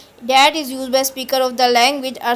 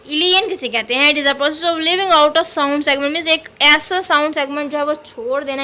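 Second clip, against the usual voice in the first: ordinary talk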